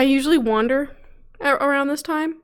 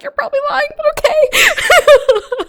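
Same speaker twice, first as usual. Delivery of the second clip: much higher, loud, laughing skeptical retort; mock doubtful reply; 7 dB louder, slower than usual. Teasing her friend's praise, high laughing disbelief. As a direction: toss back playful disbelief through a laugh. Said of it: "And I was like".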